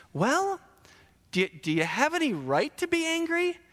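high, singsong challenge; lightly acted parental questioning; mock questioning God. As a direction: press the question on a sulking Jonah. God asks Jonah again, acted.

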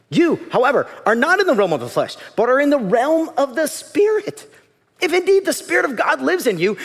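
declaimed Bible reading, climbing in intensity; scripture reading. Real, not acted. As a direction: proclaim the passage with rising fervour. Reads scripture aloud emphatically.

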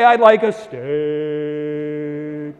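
stained glass voice. Retold or imitated. Harvard preacher ordering a steak in a stained glass voice. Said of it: "the guy in the stained glass voice".

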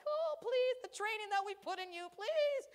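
high, loud pleading, half laughing; pleading mom. Retold or imitated. Mom begging granddaughter to be polite, mock pleading. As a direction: beg her under your breath to behave. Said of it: "and I'm like".

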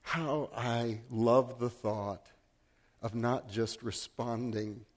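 slow down and savour each word; tender reflection. Acted. Closing reflective preaching.

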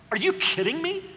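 mock disbelief; mock incredulous. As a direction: scoff at prayer in disbelief. First take incredulous.

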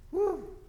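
audible exhale, then high whew; sigh of relief. Shown as a demonstration. Preacher after reading the promise of rest, relieved sigh. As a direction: let out a relieved breath.

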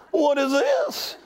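high, slow rising question; imitated bafflement. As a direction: stare at the coin and draw out the question. Woman baffled by the quarter.

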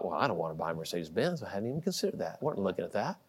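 inner dismissive thought. Retold or imitated. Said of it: "And I thought".

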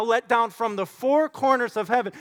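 ordinary talk, nothing marked

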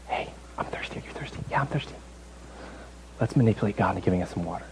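hushed scheming between companions; conspiratorial whisper. Acted. Thirsty Israelites plotting to manipulate God, whispering to each other. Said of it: "they kinda whisper to each other".

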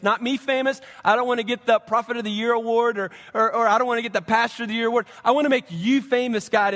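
rally the church behind it; fired-up preaching. Acted.

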